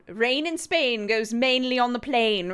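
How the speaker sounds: high, loud English accent pushed too far; caricature English accent. Shown as a demonstration. American showing how she attempts English, caricatured English accent. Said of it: "trying to sound like an English person".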